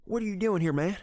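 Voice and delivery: imitating the big bearded country dude's challenge. Country dude demanding what the narrator is doing there. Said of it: "big country dude".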